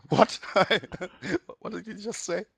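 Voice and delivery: high, laughing disbelief; high laughing mock confusion; baffled reaction. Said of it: "this guy comes along and".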